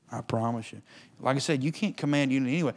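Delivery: normal talking voice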